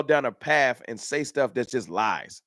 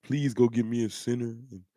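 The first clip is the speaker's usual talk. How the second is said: soft, lower, deflated pleading; a softer, droopy mock-sad plea; 4 semitones lower, 5 dB quieter than usual. Shown as a demonstration. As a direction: mope it out like a beaten fan, softly. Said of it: "I don't want all those things in a sad way".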